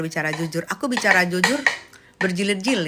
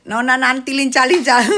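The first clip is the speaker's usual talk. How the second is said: playful tease; 3 semitones higher, 6 dB louder than usual. Acted. Teasing singsong.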